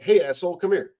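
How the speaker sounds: loud and casual, a buddy's shout; mock friendly shout across the room; friendly loud call. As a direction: call him over loud and easy. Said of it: "But if I'm like".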